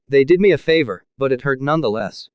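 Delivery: ordinary delivery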